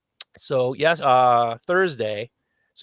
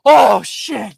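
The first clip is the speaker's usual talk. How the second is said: loud, high burst of relief; huge outburst; 8 semitones higher, 9 dB louder than usual. Retold or imitated. Patient blurting to the eye doctor, loud and high. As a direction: let it blow out big and loud. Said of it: "I let out this huge".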